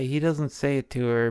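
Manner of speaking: usual speaking voice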